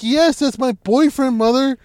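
high, loud, fed up with her mother; exasperated. Retold or imitated. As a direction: insist it to mom, raising your voice. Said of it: "She's like".

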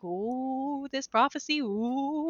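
lightly mocking the awed prophecy; mock spooky ooh. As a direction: tease it like a spooky campfire tale.

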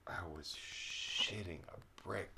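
a slow, hushed whisper. Real, not acted. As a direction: whisper it like a confession. Confessing whispered aside.

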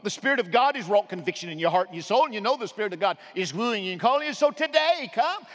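rising, urgent altar call; preaching appeal. Acted. Pastor calling people forward, urgent preaching. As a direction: call them forward now.

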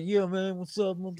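a hint of the Instagram guy's lazy hello; Squeezy Jibs impression. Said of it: "now that you do that".

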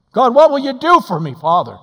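loud, high plea to God; desperate plea. Acted. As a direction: call out for help. Voicing a plea.

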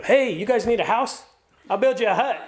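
Peter. Retold or imitated. Peter offers Moses and Elijah a house, acted eagerly. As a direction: play an overkeen helper jumping straight in.

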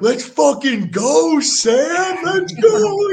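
very high, loud shouting cheer; hype shout. Retold or imitated. Linemen cheering a teammate after a fight, high loud shout. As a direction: cheer him on at full volume, rising. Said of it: "They're like".

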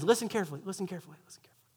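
fading to a whisper. Acted. Peter to the crowd, fading into a whisper. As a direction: drop from soft speech down to a bare whisper.

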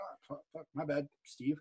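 sheepish apology. Retold or imitated. Apologizing to Steve for calling him sir, hushed. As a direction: mumble it out, caught out. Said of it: "I was like".

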